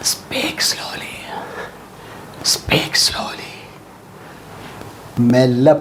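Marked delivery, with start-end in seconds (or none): 0.1-3.5 very slow, hushed whisper; drawn-out whispered phrase. Shown as a demonstration. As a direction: whisper it, stretching each word out slowly. Teacher models the phrase in a whisper.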